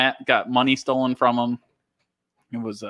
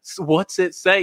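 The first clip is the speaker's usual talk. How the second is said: high-pitched demand, only mildly yelled; retold yelling; 7 semitones higher than usual. Driver demanding the friend read the sign, mock yelling. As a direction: re-enact an impatient yell without much volume. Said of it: "I was yelling at him".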